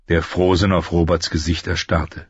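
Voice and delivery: ordinary delivery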